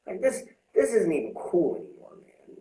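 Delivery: high, slow self-scolding; talking sense into his own drunk self; scolding himself. Retold or imitated. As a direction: tell yourself off, drawn out. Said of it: "I was just like".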